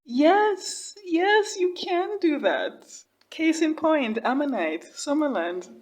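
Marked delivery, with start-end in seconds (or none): 0.0-2.9 high, thrilled shouts of yes; reacting to the cottage dream with high excited cheers. Real, not acted. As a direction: cheer the idea on, much higher. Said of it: "cottage by the sea".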